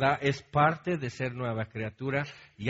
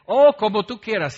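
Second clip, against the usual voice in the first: sarcastic retort; 7 semitones higher, 10 dB louder than usual. Retold or imitated. Married quarrel, sarcastic retort.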